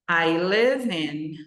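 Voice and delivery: slow, live said as "lev"; slow live demo. Shown as a demonstration. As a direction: draw out I live in slowly. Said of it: "Não é som de I".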